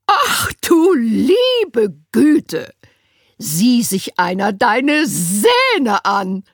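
high, loud, slow gasp then breathy whisper; horrified social worker gasp; shocked Winnie. A narrator's reading. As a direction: recoil in horror at the teeth.

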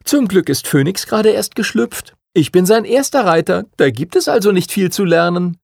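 put-on a pleased boy who thinks he's off the hook; beaming. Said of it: "Henry strahlte".

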